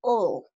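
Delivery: a long open Spanish "O", tongue behind the teeth; sound O. Shown as a demonstration. Teacher demonstrating the vowel O with tongue behind teeth. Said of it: "hacer el sonido O".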